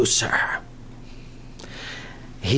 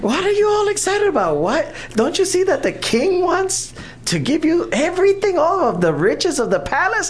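high, fast teasing friends, incredulous; mocking girls; 14 semitones higher than usual. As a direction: needle her like disbelieving girlfriends.

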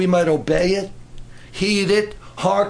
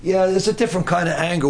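ordinary talk

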